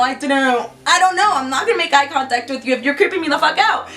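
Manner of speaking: normal talking voice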